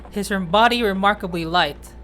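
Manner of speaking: normal talking voice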